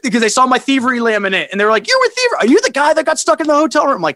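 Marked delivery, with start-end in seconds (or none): 1.9-4.0 high, eager imitation of staff; excited recognition. Catering staff spotting his laminate, excited imitation. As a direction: pitch it up like a starstruck questioner.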